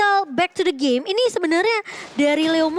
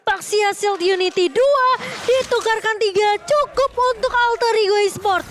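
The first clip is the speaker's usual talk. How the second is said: high, fast hyped teamfight call; fighting game announcer; 7 semitones higher than usual. Acted. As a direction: shout the action like a caster.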